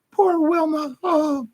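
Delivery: very high falsetto sympathy; mock pitying onlookers. Onlookers pitying mother on a bad day, mock high-voiced sympathy. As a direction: coo over her in a put-on high voice.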